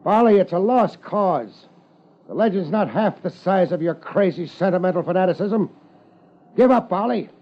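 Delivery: commanding shout. Acted. Detective orders the hidden trainer to surrender, shouting. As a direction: bark the order across the road. Gunfight shouting across road.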